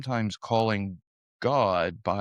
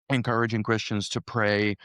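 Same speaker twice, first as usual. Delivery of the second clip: ordinary talk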